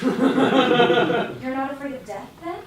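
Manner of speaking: slow laugh-filled disbelief; nervous laughing. Acted. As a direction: laugh through it, rattled. Shaken laughter at robot.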